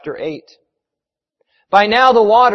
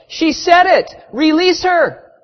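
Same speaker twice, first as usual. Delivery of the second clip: high, loud demand; urgent crowd cry; yelling from shore; 11 semitones higher, 5 dB louder, slower than usual. A narrator's reading. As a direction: shout it out, stretching the final plea. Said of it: "several from the shore yelled".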